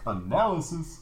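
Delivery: hype man call. Pretend. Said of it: "never yelled transphobia in a hype man voice".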